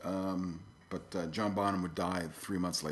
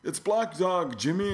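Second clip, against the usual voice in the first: high, loud heckle from the crowd; retold fan yell; 10 semitones higher, 5 dB louder, slower than usual. Concert fan yelling the song name at [name]. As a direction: shout it up at the stage. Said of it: "somebody yells".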